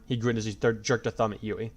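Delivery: ordinary delivery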